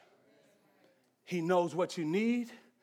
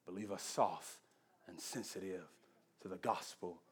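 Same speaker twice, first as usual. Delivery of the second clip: quiet closing prayer; 5 semitones lower, 3 dB quieter than usual. Acted. Pastor praying over the congregation, softly and low. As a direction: pray gently, fading to a hush.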